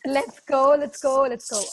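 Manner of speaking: usual speaking voice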